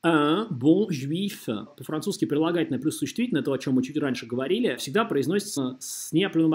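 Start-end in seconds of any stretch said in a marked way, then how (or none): none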